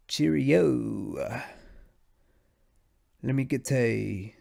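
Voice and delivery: badly faked, drawn-out British accent; British accent attempt. Trying to order food as a Brit, shaky mock British. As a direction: fumble through a slow mock British order. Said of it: "They say".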